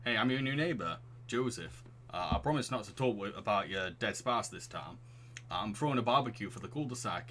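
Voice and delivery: friendly neighbour in put-on Northern English; chatty, slightly hesitant Northern accent. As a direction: invite them over as a Northern English dad. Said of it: "Why is the Northern accent fitting him".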